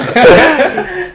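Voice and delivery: a big high burst of laughter; host laughing after teasing the student about money; hearty laugh. Real, not acted. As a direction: laugh out loud at your own joke. Laughing at own money joke.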